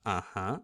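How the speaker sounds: "Uh-huh" dipping low, then rising; falling-rising "Uh-huh"; dip and rise. Shown as a demonstration. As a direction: drop "Uh-huh" and bring it back up. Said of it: "dropping, coming back up".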